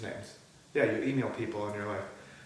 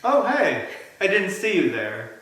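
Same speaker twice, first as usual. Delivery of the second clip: high, loud fake startle; mock surprise; 6 semitones higher, 6 dB louder than usual. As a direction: act caught off guard by the camera. Mock surprise greeting to camera.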